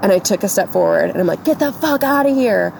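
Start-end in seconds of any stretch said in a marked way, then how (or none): 1.4-2.7 higher-pitched mock yell, not really louder; retold scream. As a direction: re-enact the yell at contained volume, pitched well up. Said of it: "like, as loud as I could".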